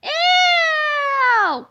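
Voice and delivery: very high, loud ew; disgusted cry. A narrator's reading. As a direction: shriek in disgust. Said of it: "cried George again".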